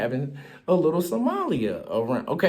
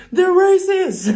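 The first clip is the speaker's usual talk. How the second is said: much higher and louder, a hammed-up indignant cry; mock outraged protester; 8 dB louder, slower than usual. Mocking a congresswoman calling out racism, high and loud. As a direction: shriek it like a caricatured activist. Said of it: "You can't just be like".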